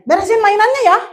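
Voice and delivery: engaged, friendly tidy-up request; right way. Shown as a demonstration. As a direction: invite the child gently to tidy up. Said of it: "Kalau gesturnya gini".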